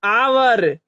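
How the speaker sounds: आवर starting on an open vowel, no H; silent H. Shown as a demonstration. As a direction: model आवर as a teacher, beginning straight on आ. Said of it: "H की ध्वनि". (आवर को बिना ह की ध्वनि के, आ से शुरू करके)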